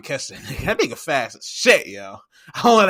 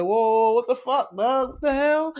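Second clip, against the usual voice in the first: high, startled mock outrage; angry coach impression; 8 semitones higher than usual. Retelling the coach's shock at players on his logo, high outraged yelp. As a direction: squeal it like a coach seeing red. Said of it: "John Harbaugh was like".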